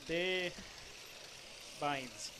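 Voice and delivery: French accent. Shown as a demonstration. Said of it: "my French accent".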